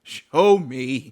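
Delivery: a loud, drawn-out dare on "Show"; loud challenge. Real, not acted. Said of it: "If you think I'm wrong".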